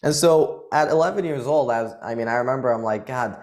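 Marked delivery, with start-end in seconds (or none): none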